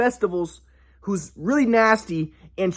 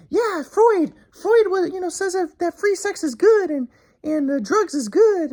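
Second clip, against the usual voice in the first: much higher, slower sneering parody voice; mocking the stepdad; 10 semitones higher, slower than usual. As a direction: lampoon a smug psychologist. Mocking the leftist stepdad's views.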